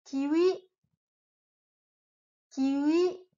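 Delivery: kiwi, slightly rising; Japanese kiwi. Shown as a demonstration. Teacher giving the word to repeat. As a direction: model kiwi for repetition. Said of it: "répète après moi".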